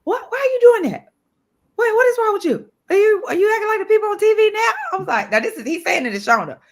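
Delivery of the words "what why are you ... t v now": imitated outraged dad, very high and loud; mock a scandalized father scolding his son; hammed-up parental outrage, pitched way up; father cussing out. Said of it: "that father damn near cussed him out".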